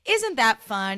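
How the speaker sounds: a hint of a beaming TV chef; Ina Garten voice. Shown as a demonstration. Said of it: "In the Ina Garten voice".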